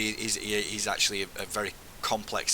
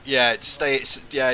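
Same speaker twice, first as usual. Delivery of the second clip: calling out; 4 semitones higher, 10 dB louder, much slower than usual. Real, not acted. Calling to squad mid-battle.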